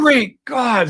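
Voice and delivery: high, loud astonished exclamation; acted shock. Orderly finding the dead man alive, shocked cry. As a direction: cry out in amazement.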